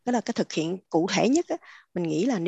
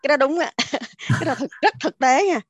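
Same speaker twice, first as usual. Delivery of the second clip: louder, higher, laughing through the words; laughing agreement; 6 semitones higher, 6 dB louder than usual. Real, not acted. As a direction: agree heartily while laughing.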